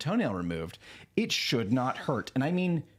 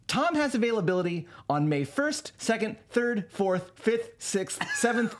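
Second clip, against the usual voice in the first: glitching AI; 8 semitones higher, slower than usual. Retold or imitated. AI glitch counting dates.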